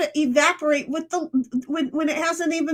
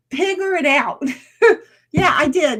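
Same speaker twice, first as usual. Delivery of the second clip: repeating it through a laugh; 3 semitones higher, 4 dB louder than usual. Real, not acted. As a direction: giggle at the slip. Repeats her own slip laughing.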